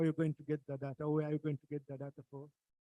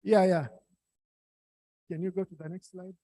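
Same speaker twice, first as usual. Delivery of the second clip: louder; 3 semitones higher, 12 dB louder than usual. Real, not acted. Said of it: "your voice is very low".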